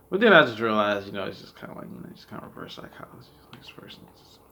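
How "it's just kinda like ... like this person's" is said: whispered aside. Real, not acted. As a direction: drop to a secretive whisper. Confiding a harsh judgment.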